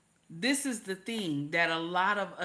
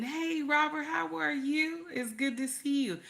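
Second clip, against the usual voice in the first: lightly higher, sugary hello; a hint of a sweet wife greeting her man; mock sweet wife voice; 7 semitones higher than usual. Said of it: "a lot of women".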